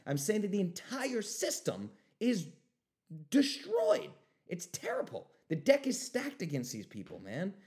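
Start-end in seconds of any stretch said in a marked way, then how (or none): 3.3-4.1 outraged squeak. Real, not acted. Outrage at the system.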